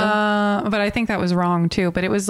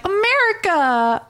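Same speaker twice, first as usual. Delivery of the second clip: eager American; 10 semitones higher than usual. Retold or imitated. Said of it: "And I was like".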